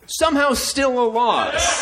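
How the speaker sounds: high, slow, laughing disbelief; exasperated laughing shout. Real, not acted. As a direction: cry it out in mock despair. Comic self-pity about debate record.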